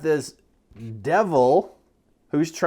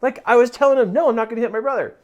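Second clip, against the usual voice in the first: put-on high, loud, eager kid recounting; excited son; 9 semitones higher, 6 dB louder than usual. Son telling dad he refused the voice, excited. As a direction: imitate a boy proudly reporting to Dad. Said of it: "And he says".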